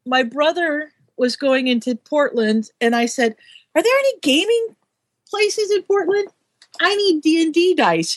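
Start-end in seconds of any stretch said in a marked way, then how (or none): 3.7-8.2 raised-pitch quote. Retold or imitated. Sue quotes herself asking brother.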